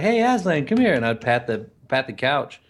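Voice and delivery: ordinary talk, nothing marked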